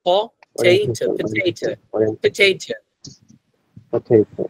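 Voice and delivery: British পটেটো, slow, with breathy aspirated p and t; British accent. Shown as a demonstration. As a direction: slowly puff out পটেটো the British way. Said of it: "ব্রিটিশরা এভাবে উচ্চারণ করে".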